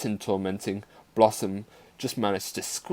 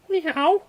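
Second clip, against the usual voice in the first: very high frightened mew; crying kitten meow; 4 dB louder than usual. A narrator's reading. Kitten threatened by cruel girl, crying meow. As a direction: meow in distress, high as you can. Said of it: "cried Blossom".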